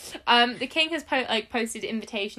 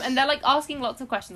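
usual speaking voice

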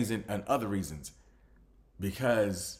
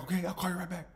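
ordinary delivery

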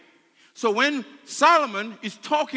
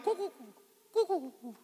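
soft imitated dove coos; turtle dove cooing; 6 semitones higher, 9 dB quieter, much faster than usual. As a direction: coo gently like a turtle dove. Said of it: "It's the sound of the turtle dove".

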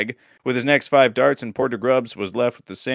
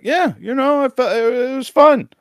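much higher, strained put-on voice; squeaky impression of a mellowed player, louder on the last word; Surgeon; 13 semitones higher, 4 dB louder than usual. Podcaster imitating a less angry player, high put-on voice. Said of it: "such a good Surgeon impression".

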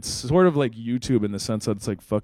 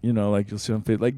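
ordinary talk, nothing marked

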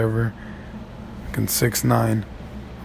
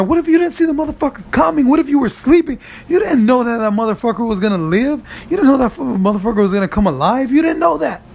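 heated high rant; 9 semitones higher, faster than usual. Real, not acted. Ranting at imagined doubters.

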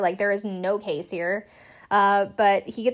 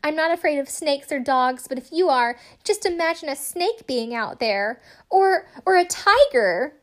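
imitating LaToya; 6 semitones higher, 4 dB louder than usual. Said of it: "LaToya does".

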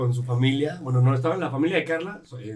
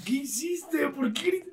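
mock shock; 10 semitones higher, 6 dB quieter, much slower than usual. Relatives' reaction, retold.

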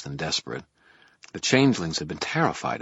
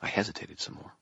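ordinary delivery, nothing marked

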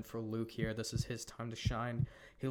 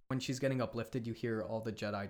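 ordinary talk

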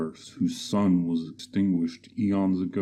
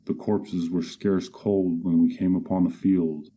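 ordinary delivery

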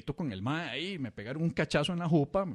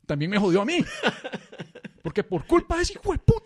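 mock rant; 5 semitones higher, 6 dB louder than usual. Barber ranting, high loud laugh.

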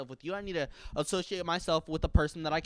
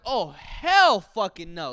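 much louder and slow, dragged-out refusal; loud outrage; 12 dB louder, much slower than usual. Retold or imitated. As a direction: belt it out in disbelief. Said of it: "And I was like".

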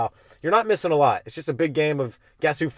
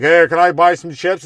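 put-on drunk guy asking; 3 semitones higher, 7 dB louder than usual. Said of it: "this guy".

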